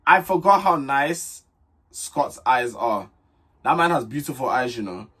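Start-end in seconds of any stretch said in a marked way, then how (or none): 0.0-3.1 slow, drawn-out admiration. Real, not acted. Vlogger gushing over Scott's eyes, slowed right down. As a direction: take it slowly, lingering over each part. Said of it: "slow down".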